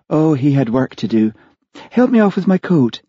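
lightly voiced lady, pitched high; airy lady guest. Olivia to Charlie on arriving alone, airy lady guest. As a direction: brush it off airily, then request. Guest airily explains husband's absence.

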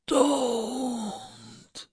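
high, faint whispered plea; dying whisper. Acted. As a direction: breathe it out, barely alive. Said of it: "the man whispered".

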